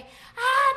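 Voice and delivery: high, loud gym shout; copying the whole class screaming; imitated crowd yell. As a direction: let out a big group roar. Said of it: "todo el mundo grita".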